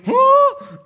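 high, loud baffled huh, then puzzled questions; confused huh. Retold or imitated. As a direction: play the lost Bible reader, squeaking out the confusion. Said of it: "you open the Bible and you go".

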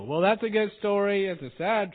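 ordinary talk

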